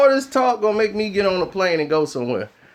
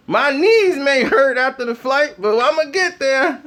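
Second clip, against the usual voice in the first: normal talking voice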